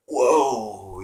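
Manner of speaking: stunned by a revelation; amazed whoa. Retold or imitated. Awakening moment in the dream.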